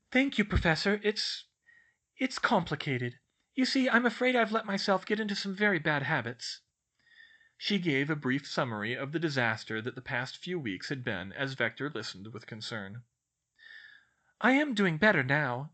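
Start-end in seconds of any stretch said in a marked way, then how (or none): 0.1-6.6 put-on higher girlish voice with a halting start; hesitant confiding. Schoolgirl confesses trouble to her professor, hesitantly. 14.4-15.7 put-on higher, louder girlish assurance; assuring. A narrator's reading. Schoolgirl assures her concerned professor, earnestly. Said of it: "she assured her".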